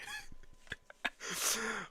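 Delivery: high airless wheeze of a laugh; breathless laugh. Real, not acted. Laughing at the name.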